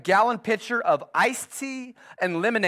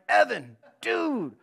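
exasperated dad; 8 semitones higher, slower than usual. Retold or imitated. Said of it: "So I'm like".

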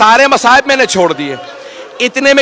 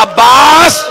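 impassioned sermon recitation; 4 semitones higher than usual. Acted. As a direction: build the mourners toward tears. Majlis recitation rising.

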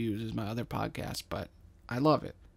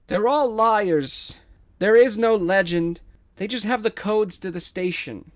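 loud accusation; 8 semitones higher, 9 dB louder than usual. Acted. Gwen shouting to the crowd about the liars, loud. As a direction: call them out to the crowd.

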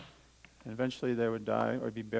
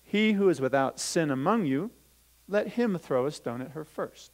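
louder, lifted scripture reading; Bible reading voice; 4 semitones higher, 5 dB louder than usual. Acted. Teacher reading Jesus' words to the children, lifted reading voice. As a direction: proclaim the verse to the class. Reads scripture, then repeats it.

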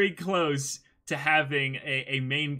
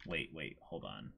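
quiet; 10 semitones lower, 13 dB quieter than usual. Real, not acted. Stopping the scroll to check something, quiet and low. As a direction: drop much softer and lower, almost to yourself. Stops co-host while scrolling.

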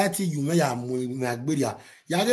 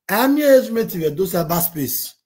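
higher, louder mock of "age mate"; loud mocking jeer; 5 semitones higher, 5 dB louder than usual.

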